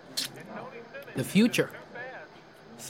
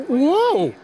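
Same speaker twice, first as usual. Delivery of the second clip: surprised whoa; 8 semitones higher, 5 dB louder than usual. Acted. Sudden surprise at tendon on wing.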